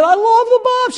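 high-pitched, eager gushing; acted enthusiasm. Acting excited self on date.

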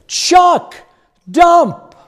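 high, loud call. Retold or imitated. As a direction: call his name, then the word. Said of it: "the pastor said".

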